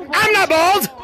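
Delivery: high mock-protest yell; countdown scream. Real, not acted. Streamer screaming with players on three, high playful yell. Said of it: "On three, we're gonna scream bald".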